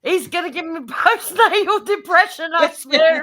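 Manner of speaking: high, loud, laughing through the words; half-laughing grumble about him; laughing complaint. Real, not acted. Wife laughing about her husband's blunders, high and giggly.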